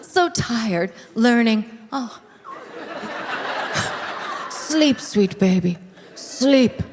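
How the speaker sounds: put-on soft, tender cooing to a baby. Adults cooing over a sleeping baby, soft tender. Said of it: "everybody's like".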